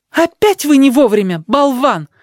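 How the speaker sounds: high angry outburst. Acted. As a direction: snap at him in anger. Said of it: "разгневалась Шейла".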